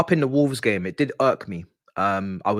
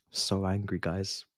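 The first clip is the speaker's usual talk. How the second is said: quiet anger; 4 semitones lower, 9 dB quieter, slower than usual. Real, not acted. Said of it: "I'm so angry".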